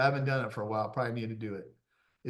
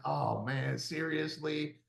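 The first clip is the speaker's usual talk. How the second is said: soft, slow disbelief; weary mock dismay; 9 dB quieter, slower than usual. Client hearing the trainer's next order, weary dismay. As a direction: let it sag quietly, like a beaten client.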